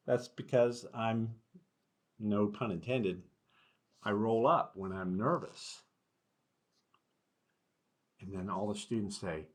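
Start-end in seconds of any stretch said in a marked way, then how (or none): none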